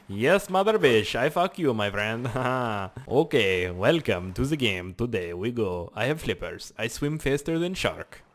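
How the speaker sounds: mock foreign accent, slow and drawn out; broken-English comic voice; goofy foreign character. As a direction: play a boastful foreign swimmer, stretching the words. Puts on a foreign-sounding game character.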